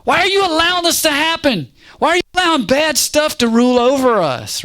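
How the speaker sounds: high, strained cry of protest; loud, high-pitched desperate complaint. Acted. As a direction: cry out accusingly at God. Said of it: "he's crying out saying".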